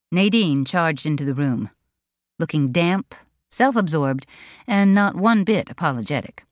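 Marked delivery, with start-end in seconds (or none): none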